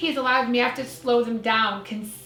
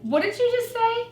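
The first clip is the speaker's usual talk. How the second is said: startled question; 12 semitones higher than usual. Retold or imitated. Startled man retold, pitch leaps.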